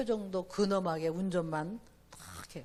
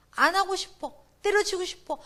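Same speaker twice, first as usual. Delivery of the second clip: put-on rebellious teen; 9 semitones higher, 9 dB louder than usual. Voicing rebellious teens.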